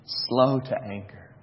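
slow, low and soft, every word weighed; solemn reading. Shown as a demonstration. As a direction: lay it down gravely. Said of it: "Thirdly, he says".

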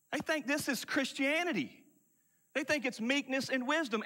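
normal talking voice

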